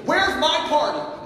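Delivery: high, loud, slow "Where's my party?"; older son whining loudly to his father; petulant demand. Retold or imitated. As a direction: stretch it out like a sulking child. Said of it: "is what he's saying".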